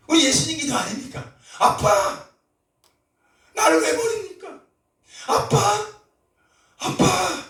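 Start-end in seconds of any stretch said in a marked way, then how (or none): 1.6-7.4 high, pleading cry to father; Jesus forsaken, crying to his father; anguished, pleading prayer pitched high. Acted.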